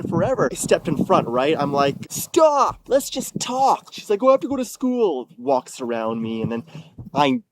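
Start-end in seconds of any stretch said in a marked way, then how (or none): 2.1-3.9 high-pitched call to stop; urgent, raised-pitch appeal; retold urgent plea. Retells stepping in front of her. 4.2-5.3 higher, quick brush-off; dismissive imitation of the ex; imitating ex-girlfriend. Voices ex making an excuse.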